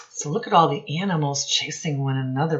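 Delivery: normal talking voice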